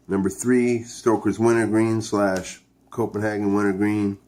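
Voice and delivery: ordinary delivery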